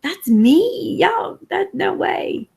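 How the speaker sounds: high-pitched retold astonishment; retold disbelief. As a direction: react as if stunned by your own video. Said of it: "And I was like".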